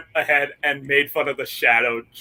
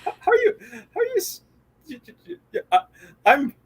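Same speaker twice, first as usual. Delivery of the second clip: stammering shock; 7 semitones higher, faster than usual. Retold or imitated. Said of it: "Just like".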